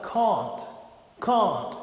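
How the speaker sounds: "can't" with the British long A, like "cont"; British "can't" with a back, O-like vowel; British accent. Shown as a demonstration. Teacher demos the British "can't" against the American one. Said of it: "É como se esse E virasse um O".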